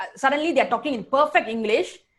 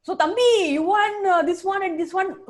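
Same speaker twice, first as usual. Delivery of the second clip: imitated Indian accent; 8 semitones higher than usual. Hawker addressing a foreign worker, high mock Indian accent. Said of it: "full on Indian accent coming out".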